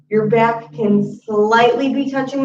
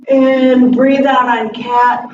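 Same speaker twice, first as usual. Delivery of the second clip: drawn-out, slow and a bit louder; calm slow yoga instruction; slow exhale cue; 3 semitones higher, 5 dB louder, slower than usual. Shown as a demonstration. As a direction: stretch the words over a long out-breath. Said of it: "yoga cue paced to exhale".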